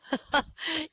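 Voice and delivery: high, far-off burst of laughter; hysterical laugh. Acted. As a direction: cackle helplessly. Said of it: "laughing hysterically".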